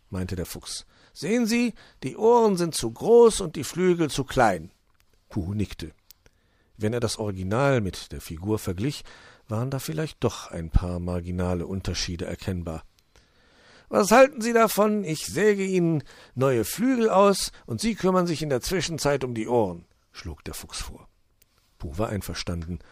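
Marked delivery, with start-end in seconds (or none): 1.1-4.7 high, loud, jolly fox voice; high-pitched welcoming fox; cheerful fox. Acted. Fox voice held. 13.9-19.9 high, loud, jolly fox voice; high-pitched welcoming fox; cheerful fox. Acted. Fox voice held.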